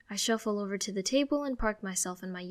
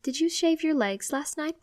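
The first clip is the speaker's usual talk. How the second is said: questioning; 8 semitones higher, 4 dB louder than usual. Acted. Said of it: "she questions".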